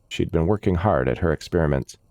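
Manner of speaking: usual speaking voice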